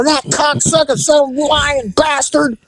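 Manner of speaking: high-pitched mock rant; imitated angry cursing. Woman cursing the departing teller, imitated high-pitched rage. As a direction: spit out curses high, mimicking her outrage.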